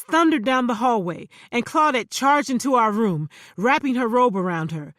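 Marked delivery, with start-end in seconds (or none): none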